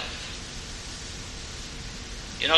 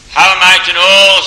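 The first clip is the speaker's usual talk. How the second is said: much louder and higher, an anguished plea; desperate cry; 5 semitones higher, 12 dB louder than usual. Acted. Bartimaeus pleading to know he is heard, desperate loud cry. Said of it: "says Bartimaeus".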